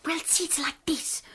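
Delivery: hushed conspiratorial whisper. Acted. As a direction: lean in and whisper it. Secret talk about his mum.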